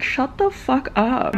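acted exasperation, not shouted; mock annoyed outburst. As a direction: stretch it out like a fed-up neighbour. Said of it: "I would be like".

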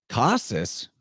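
"Khasis" as KAH-sis, hard K; K version. Shown as a demonstration. Host comparing the name to chaos, "Khasis" said KAH-sis. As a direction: isolate "Khasis" with a crisp K and falling tune. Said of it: "the K version".

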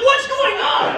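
high-pitched mock cry of confusion; bewildered yell. Diner reacts to beatboxing chef, high bewildered mock yell. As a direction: shout it way up high, hammed-up bafflement.

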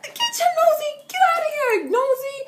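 very high, squealed telling-off; mock scolding. Mock scolding a nosy boy.

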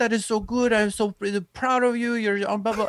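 high, gushing voice; imitating gushing supermodel. Supermodel congratulating the comic, high gushing imitation. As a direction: gush up high like a proud friend.

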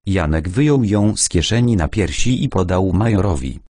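plain reading voice

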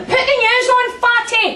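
high loud bark at mum; daughter yelling. Retold or imitated. Daughter yelling at mum to turn on the news, high and loud. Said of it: "She went".

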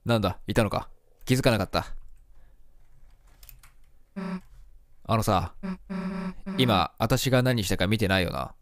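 put-on low, quiet tough girl. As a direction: voice Susie low and sullen. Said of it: "え、じゃ、この声間違ってる？". (低めの不良少女の声)

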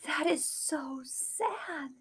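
slow, whispered sorrow; sad whisper. Acted. Kate hears of the starving widow, sad whisper. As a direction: breathe it out softly, sorry for them.

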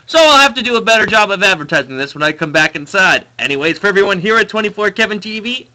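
loud, upbeat outro voice; streamer sign-off. Real, not acted.

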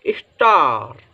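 "स्टार" with a drawn-out falling vowel; model pronunciation. Shown as a demonstration. Teacher sounds out the word for students, "स्टार" drawn out. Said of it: "तो इसकी ध्वनि क्या निकलेगी".

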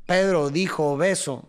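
"Pedro dijo beso" slowly, one word per beat; accent the first syllable of "Pedro", "dijo" and "beso"; measured pulse through "Pedro dijo beso"; rhythmic demo. Shown as a demonstration. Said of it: "esa es la musicalidad".

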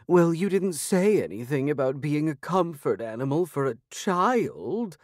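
sniffy, disdainful talking cat; high, put-upon feline diva; haughty cat voice. Acted.

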